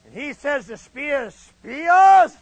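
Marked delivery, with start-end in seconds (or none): 1.3-2.3 loud, high, drawn-out sergeant's bellow; Flanagan. Retold or imitated. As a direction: bellow the recruit's name. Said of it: "he talked just like this".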